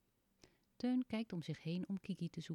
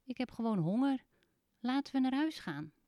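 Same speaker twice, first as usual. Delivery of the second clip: fibbing; 6 semitones higher, 7 dB louder than usual. Acted. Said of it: "jokt Teun".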